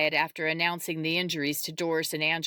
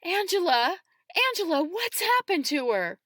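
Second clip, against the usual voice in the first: high, alarmed cry; distressed woman, pitch leaping up; 13 semitones higher, 4 dB louder than usual. A narrator's reading. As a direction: call out in sudden panic. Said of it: "She cries out".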